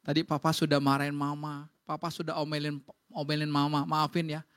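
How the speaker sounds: low-pitched, subdued acted apology; acted contrite husband, pitched well down; apologetic husband. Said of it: "suaminya bilang gini".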